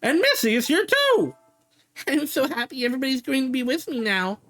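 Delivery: put-on little girl voice. The girl greets her dog, childlike and high. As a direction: play a small girl relieved to see her dog.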